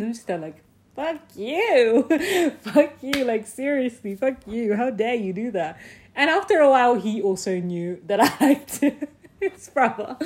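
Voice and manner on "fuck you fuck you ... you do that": laughing retort. Retold or imitated. Retort to friends' teasing.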